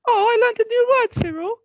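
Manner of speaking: very high, loud sing-out; mock-innocent wife. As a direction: chirp it high and loud to wind him up. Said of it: "she'd be like".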